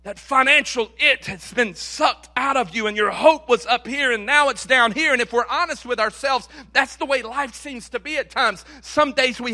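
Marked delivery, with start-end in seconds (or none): none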